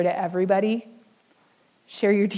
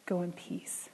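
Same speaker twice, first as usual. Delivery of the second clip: gentle blessing; 2 semitones lower, 12 dB quieter than usual. Acted. Jesus' gentle blessing to woman.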